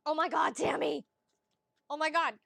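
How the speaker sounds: loud, amazed outburst; loud, thrilled disbelief; excited exclamation. Real, not acted. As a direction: burst out in real excitement. Delighted by viewer Tammy's message.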